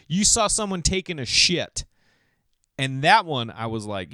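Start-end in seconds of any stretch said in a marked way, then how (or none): 0.1-1.8 mock astonishment. Audience member's guess, acted.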